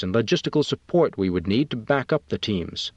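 plain reading voice

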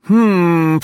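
sharp, high and loud hmm; a high, loud put-on woman's snap; snappy hmm. A narrator's reading. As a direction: snap it from the back seat. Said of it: "Nora snapped".

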